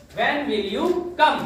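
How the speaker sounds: very slow, word by word; slow teaching read. Shown as a demonstration. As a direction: model it slowly. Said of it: "तुम कब आओगे?". (बहुत धीरे, शब्द-शब्द)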